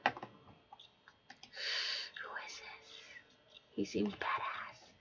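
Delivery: soft whispered aside. Real, not acted. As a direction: lean in and whisper it. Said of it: "whispered aside to audience".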